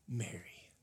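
whispered, tender name; Jesus gently calling Mary's name; sweet gentle Jesus. Acted. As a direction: breathe her name softly. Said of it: "such sweet gentleness in his voice".

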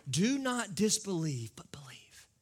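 whispered plea; Jesus whispering to Thomas; gentle Jesus. Acted.

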